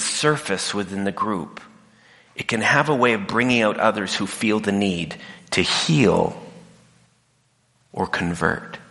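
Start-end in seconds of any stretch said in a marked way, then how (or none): none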